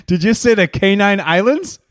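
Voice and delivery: loud, high, slow rising question; mocking disbelief. Real, not acted. Co-host picks on a garbled island name, mocking disbelief. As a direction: draw out the botched name incredulously.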